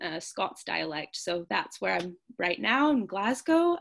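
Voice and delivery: ordinary talk, nothing marked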